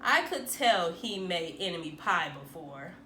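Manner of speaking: usual speaking voice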